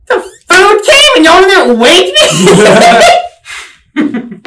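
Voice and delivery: mock outrage through laughter; laughing outrage. Laughing retelling of outraged question.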